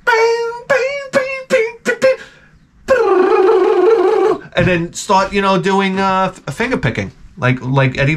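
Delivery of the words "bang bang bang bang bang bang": very high pinging bang bang, sung like harmonics; guitar harmonics imitation. Vlogger imitating guitar harmonics, high pinging voice. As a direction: mimic ringing guitar harmonics. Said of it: "It's like".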